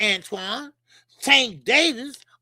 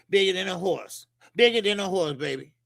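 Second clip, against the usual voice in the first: usual speaking voice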